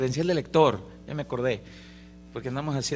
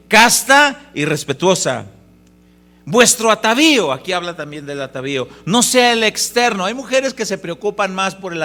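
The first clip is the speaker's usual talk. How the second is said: loud, fervent sermon delivery; preaching at full force; 3 semitones higher, 9 dB louder than usual. Acted. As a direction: proclaim the verse to the church. (predicando con fuerza)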